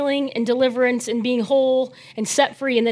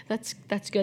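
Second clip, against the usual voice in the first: normal talking voice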